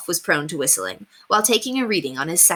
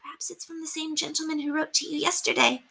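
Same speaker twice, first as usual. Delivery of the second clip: soft, high murmured aside; confidential squeak; 10 semitones higher, 6 dB quieter than usual. Acted. Timid maid squeaks a confidential aside. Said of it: "Margaret squeaked in a confidential tone".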